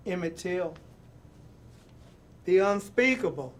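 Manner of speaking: rhythmic, building reading with falling endings; dramatic poem reading. Acted. Host reading a picture book poem to young viewers, rousing. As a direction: read the poem like a rallying call. Reads The Undefeated poem aloud.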